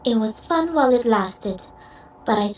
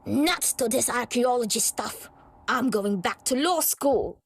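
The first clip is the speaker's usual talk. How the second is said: much softer, muttered giving-up; a defeated rival muttering to herself; grumbling aside; 8 dB quieter than usual. Acted. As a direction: sulk it under your breath.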